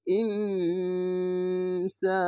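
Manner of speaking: ইনসা chanted very slowly with the ন held as a nasal hum; ইনসা with a long nasal ghunna, very slow; nasal ghunna recitation. Shown as a demonstration. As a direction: hum the ন through the nose before সা. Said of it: "Bangla অনেস্কারের মতো উচ্চারণ করতে হবে".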